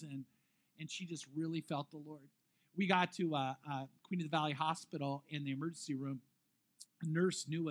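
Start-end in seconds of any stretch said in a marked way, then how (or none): none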